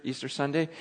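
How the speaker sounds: ordinary talk, nothing marked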